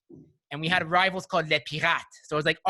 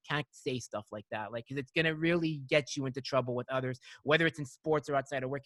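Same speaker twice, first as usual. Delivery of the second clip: lower, softer, kind advice; said nicely; 4 semitones lower, 7 dB quieter than usual. Retold or imitated. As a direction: gently set him straight. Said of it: "it said to him nicely".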